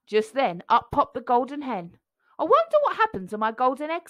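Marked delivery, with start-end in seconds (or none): 2.4-4.1 quick hen. A narrator's reading. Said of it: "said the golden hen".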